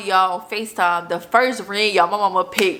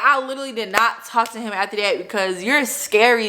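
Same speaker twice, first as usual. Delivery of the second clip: usual speaking voice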